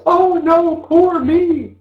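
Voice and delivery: high, whiny poor-me voice; martyr voice. Retold or imitated. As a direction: wail it like a self-pitying martyr. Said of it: "we've all got this martyr voice".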